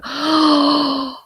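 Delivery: startled gasp at the dog; sharp gasp. Real, not acted. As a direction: catch your breath in alarm. Sees the dog into something.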